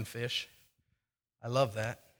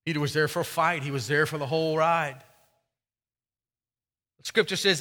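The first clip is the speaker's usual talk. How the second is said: usual speaking voice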